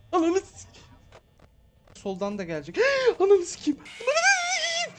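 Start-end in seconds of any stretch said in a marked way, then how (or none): 0.1-0.8 high, loud cry of fright; startled yell. Real, not acted. Jumpscare in horror game. 3.0-4.9 gasp, then a long, very high, loud, stretched shriek; gasp into scream. Real, not acted.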